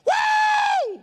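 very high, loud drawn-out whoop; freak-out whoop. Acted. Dad freaking out the whole house, loud high whoop. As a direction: shriek a long morning whoop. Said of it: "Freak your whole house out".

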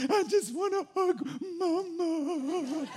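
soft, high, very slow mock whimper; crying daughter. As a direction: plead for Mama like a sobbing child. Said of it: "What are you crying about?".

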